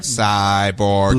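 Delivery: low robotic voice; flat mechanical robot. Pretend. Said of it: "Do you like my robot voice?".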